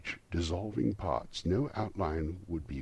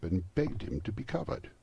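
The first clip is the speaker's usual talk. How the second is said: ordinary delivery, nothing marked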